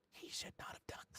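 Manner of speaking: quick, hushed whisper. Acted. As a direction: whisper a secret disapproving aside. Voice in the player's ear.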